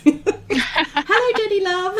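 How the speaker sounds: high, laughing campy diva greeting; campy drag queen. Retold or imitated. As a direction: camp it up, giggling. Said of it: "answer my phone at work one day and say".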